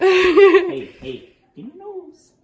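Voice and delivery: high playful coaxing, laughing; talking to a dog. Real, not acted. Asking the dog again.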